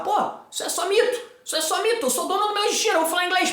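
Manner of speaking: high, excited self-encouragement; imagined pep talk. Pretend. Teacher voicing a learner who has just broken free of the myths, excited and high. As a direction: pump yourself up, pitch lifted high. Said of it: "você vai pensar".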